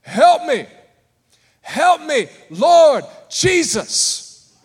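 high, loud desperate cry for help; screaming for help. Retold or imitated. Lady in a mall fight screaming for help, loud and high. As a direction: wail it out like a woman being hurt. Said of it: "she screams out and yells".

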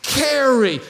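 full-force preaching climb. Acted. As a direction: preach it with growing fire. Building preached exhortation.

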